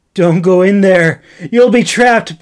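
loud, high desperate plea; high loud urgent pleading; pleading shout. Acted. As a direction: beg her to stop, shouting. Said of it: "Ben pleaded".

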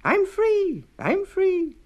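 a tiny cookie shouting with joy; high joyful little voice; gleeful gingerbread boy. A narrator's reading.

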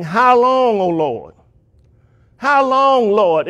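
high, drawn-out cry to the Lord; loud crying out. Acted. Souls under the altar calling to the Lord, crying out. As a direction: plead upward on "how long", then fall. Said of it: "they cried with a loud voice".

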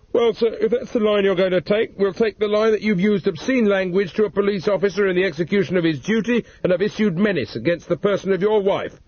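lightly voiced official police warning; stern officer. A narrator's reading. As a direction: lay down the law formally. Sergeant threatens charges.

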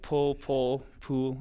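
"Paul" and "pool" said nearly alike; London vowels. Shown as a demonstration. Runner showing how Londoners merge "Paul" and "pool". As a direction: run "Paul, Paul, pool" together as near-identical London words. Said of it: "the way we pronounce certain words".

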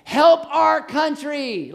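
slow, high, loud plea; pleading voters. Retold or imitated. Preacher mimics election-time voters, slow high loud plea. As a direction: drag it out like begging the nation. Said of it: "it's like every four years".

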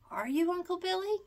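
high, worried question; anxious. A narrator's reading. Peter worried his friend will sleep all winter, anxious. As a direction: ask fearing the answer. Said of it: "he asked anxiously".

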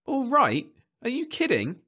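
very high, loud disbelief; incredulous. Acted. Husband disputing counsellor's good news, incredulous. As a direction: squeal it back in disbelief.

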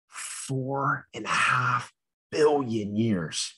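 drawn out very slowly; slow and dramatic. Acted. Teacher wowing kids with a huge number, slow and drawn out. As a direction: stretch out each word for drama. Building suspense on a big number.

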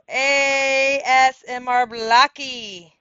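slow, loud, drawn-out spelling; calling out a name. Real, not acted. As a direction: read the username out letter by letter, stretched.

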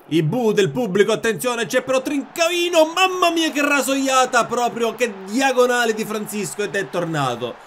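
rising, loud match commentary; excited play-by-play. Real, not acted. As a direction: call the chance like a TV commentator. (telecronaca concitata)

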